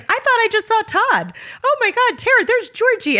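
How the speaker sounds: high-pitched mock excitement at a sighting; excited onlooker. Stranger spotting Todd and Georgie at the farm, excited.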